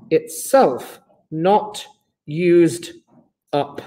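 word by word, with gaps; measured, separated words; slow dictation. Real, not acted. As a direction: dictate it slowly for note-takers. Dictating definition for notes.

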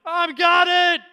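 high, loud determined cry; shouted reply. Retold or imitated. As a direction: yell it back mid-sprint. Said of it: "I'm like".

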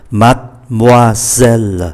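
measured "mademoiselle" as mad-moi-zel; slow "mademoiselle" with the e's silent; three syllables. Shown as a demonstration. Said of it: "còn lại ba". ("mademoiselle" đọc chậm ba âm tiết, e câm)

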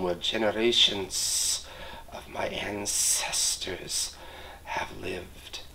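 soft, slow snake hiss, fading to a whisper; a snake breathing a warning into a sleeper's ear. Acted. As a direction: hiss each word close to his ear. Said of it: "hissed in his ear".

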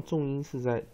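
ordinary talk, nothing marked

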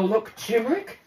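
usual speaking voice

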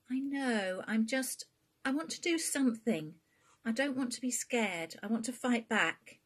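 a frightened but defiant daughter; determined daughter. Acted. Bea's voice in dialogue.

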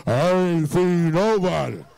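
very low, loud, drawn-out imitation. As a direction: drop it deep and slow, hammed-up denial. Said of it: "She done got deeper".

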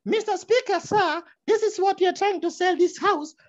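very high shrill parliamentary voice; mock Thatcher shrilling at the Speaker; high shrill MP addressing the Speaker; shrill Thatcher impression. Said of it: "she used to speak like this".

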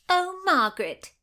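high, loud, nasal insistence; insistent. A narrator's reading. Madge presses Margaret about Lady Anne, insistent. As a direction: press the point in a high twang. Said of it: "Madge insisted".

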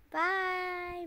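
ordinary delivery, nothing marked

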